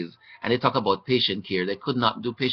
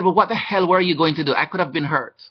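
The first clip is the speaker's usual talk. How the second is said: raised, indignant retelling; mock outrage at the nurse; retold angry demand; 6 semitones higher, 3 dB louder than usual. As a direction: staff member hears an angry retold challenge.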